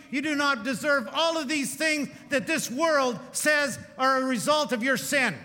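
loud, high, driving pulpit delivery; fiery sermon climax, loud and high; full-force preaching. Acted. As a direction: hammer each line home to the congregation.

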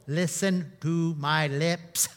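mock slow overarticulation; overenunciated. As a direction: mouth it out slowly. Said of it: "we will go".

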